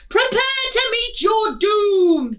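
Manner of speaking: high, loud squeaky knight battle cry; tiny knight's cry. Acted. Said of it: "he cried".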